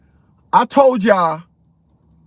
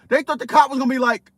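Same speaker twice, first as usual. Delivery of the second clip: ordinary delivery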